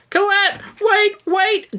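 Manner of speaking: put-on very high, pleading young man. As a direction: beg her to stay, squeaky.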